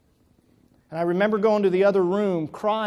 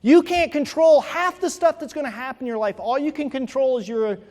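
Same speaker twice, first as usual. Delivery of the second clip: loud, fast rallying exhortation; preaching at full force; 6 semitones higher, 7 dB louder than usual. Acted. Speaker rousing the audience about adversity, loud and fast. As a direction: drive it home to the crowd, building.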